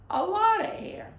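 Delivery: sudden high loud push on "a lot of air"; big emphatic burst on the exhale. Shown as a demonstration. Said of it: "make it more emphatic".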